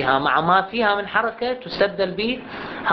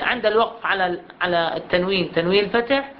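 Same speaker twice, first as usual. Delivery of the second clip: ordinary talk, nothing marked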